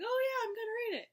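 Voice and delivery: high, rapid mock enthusiasm; eager self-quote. Vlogger quoting herself after buying the book, eager and high. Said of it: "I was like".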